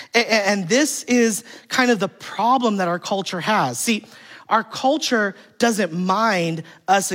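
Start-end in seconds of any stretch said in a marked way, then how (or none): none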